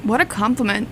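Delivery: ordinary talk, nothing marked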